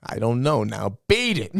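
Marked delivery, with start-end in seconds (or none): 1.1-1.6 put-on Chicago accent. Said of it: "most Chicago accent".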